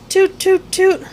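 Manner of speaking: high, loud toot-toot call; bright shouted toots. A narrator's reading. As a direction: toot it out like a proud little flute. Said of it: "Piglet shouted".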